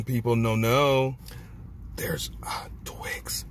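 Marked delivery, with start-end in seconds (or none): 2.0-3.4 whisper. Real, not acted. Revealing the hidden filling.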